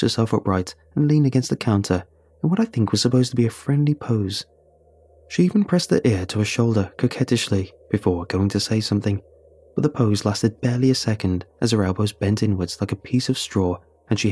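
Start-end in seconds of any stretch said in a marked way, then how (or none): none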